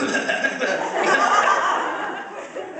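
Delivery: high, loud overblown coughing fit; huge fake cough. Comic out-coughing a girl at the bus stop, hammed-up. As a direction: outdo her with a giant cough.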